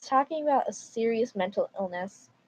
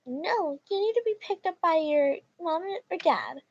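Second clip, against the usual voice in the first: entitled mom; 7 semitones higher than usual. Acted. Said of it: "Titled Mom".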